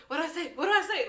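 high, rising mock panic; hammed-up panicking boyfriend; panicked. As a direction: fret like a caught cheater. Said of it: "she's texting me".

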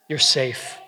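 steady, even "You're safe."; plain reassurance. Acted. God reassuring a doubting listener, plain and steady. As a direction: say "You're safe." simply and firmly. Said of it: "the King of the universe saying".

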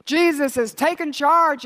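loud, high and slow; Jesus ringing out, charge held long; triumphant proclamation. Acted.

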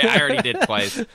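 laughing high and loud through the words. Real, not acted. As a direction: crack up while saying it.

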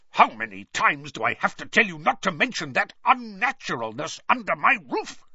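seething rebuke to the ungrateful nephew; hissed. A narrator's reading. Uncle scolding Harry for mentioning magic, furious hiss. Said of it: "he hissed".

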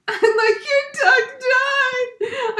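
very high, very slow, tearful wail; laugh-crying wail. Real, not acted. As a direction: stretch it into a laughing sob. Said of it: "I'm so sad".

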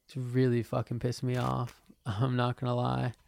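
ordinary talk

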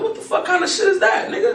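high, shocked protest; can't believe the ruling; outraged disbelief. Retold or imitated. Battler hearing judges' decision, outraged high protest. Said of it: "I'm like".

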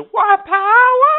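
very high, stretched holler; long, loud mock cry; retold yelling. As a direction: imitate a relentless street yeller. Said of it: "before he stopped yelling".

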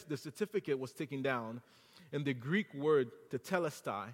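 usual speaking voice

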